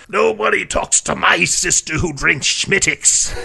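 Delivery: put-on high, loud, slow drunken Irish brogue; tipsy Irish warning, half laughing; drunk Irishman. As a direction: growl it like a scowling drunk in a pub. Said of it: "he goes".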